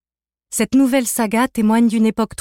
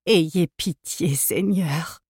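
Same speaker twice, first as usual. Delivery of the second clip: slow, softer plea to God; lower, slow prayerful plea; imploring; 3 semitones lower, 4 dB quieter, much slower than usual. Acted. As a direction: beg for mercy, drawn out. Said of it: "implora alors une voix féminine".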